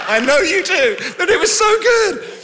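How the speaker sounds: high, breathy laughter through the words; laughing. Real, not acted. Preacher laughing with the congregation, laughing through words. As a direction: laugh it out, tickled.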